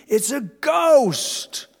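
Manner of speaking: high, slow, drawn-out scream of fright; terrified cry. Acted. Panicked disciple screaming at a ghost on the sea.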